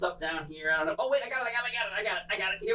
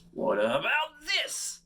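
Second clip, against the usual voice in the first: dramatic reveal; 10 semitones higher, much slower than usual. Pretend. Showman reveals new wand.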